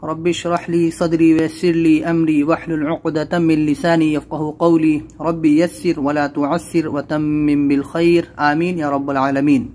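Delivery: slow, devout opening dua; prayer. Real, not acted. Opening dua before lesson.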